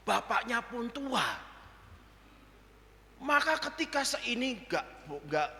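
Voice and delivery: ordinary delivery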